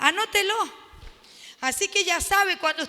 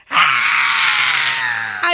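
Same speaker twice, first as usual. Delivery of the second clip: loud, very low demonic roar; deep demonic roar at Jesus; demon scream; 8 dB louder than usual. Acted. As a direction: let out a deep guttural howl. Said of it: "lanzó un gran grito".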